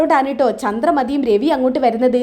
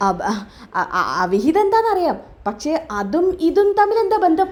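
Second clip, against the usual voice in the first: stammering into a puzzled question; acted tongue-tied bewilderment; flustered stammer; 3 semitones higher than usual. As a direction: trip over the first word, then rise in pitch. Acted flustered reply about the affair.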